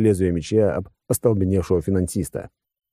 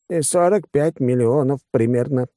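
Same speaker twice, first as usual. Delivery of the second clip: higher, reluctant estimate; squeezed out reluctantly; 7 semitones higher than usual. A narrator's reading. Finance director cornered over the price, squeezing it out reluctantly. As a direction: force the answer out, raised pitch. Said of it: "выдавил из себя финдиректор".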